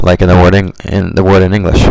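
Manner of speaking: usual speaking voice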